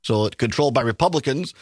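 usual speaking voice

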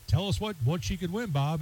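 lightly mimicked game-show host, high and soft. Game show host asks the announcer for the prize, light mimicry. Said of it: "they say".

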